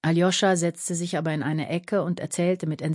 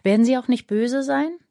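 put-on higher, anxious little boy; a child's cautious question, pitched up; lightly voiced boy; 7 semitones higher, 4 dB louder than usual. A narrator's reading.

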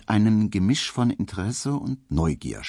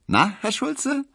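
high, teasing call to the postman; maid calling teasingly; slower than usual. A narrator's reading. Maid teases the postman, calling out high and playful. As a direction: tease him from across the street. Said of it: "rief das Mädchen".

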